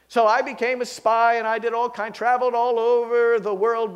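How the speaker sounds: put-on a proud old dog bragging about his career; talking dog telling tales. Dog bragging to the visitor about his spy days, in a lightly voiced, eager tone.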